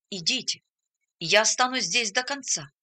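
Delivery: ordinary delivery, nothing marked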